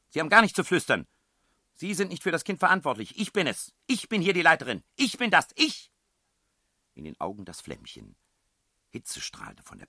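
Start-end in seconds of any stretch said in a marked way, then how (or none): 0.1-5.9 high, loud tirade of self-assertion; furious shouting. A narrator's reading. Headmistress claims charge of the child, shrieking furiously. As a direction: shout them down, hammering your authority. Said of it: "schrie die Frau".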